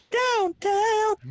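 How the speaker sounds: high and drawn out; slow falsetto. Acted. Narrator sends the scene to the docks, slow falsetto. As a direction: stretch it out in falsetto.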